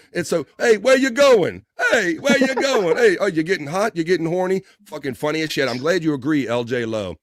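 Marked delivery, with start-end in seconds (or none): 0.6-4.6 loud, higher mocking heckler taunts. Comic taunting a heckler, loud and high. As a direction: jeer like a comic working the room. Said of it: "He's like".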